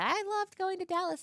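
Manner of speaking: mock gushing. Mimicking a social media post.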